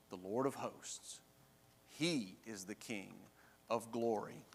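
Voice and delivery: low, soft, weighty pronouncement; hushed solemn answer. Acted. Company gives the sentries the final response, quietly solemn. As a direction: drop the voice and let each phrase settle.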